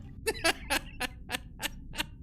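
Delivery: high wheezing cackle; breathless laugh. Real, not acted. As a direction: crack up helplessly. Cracks up at spaghetti line.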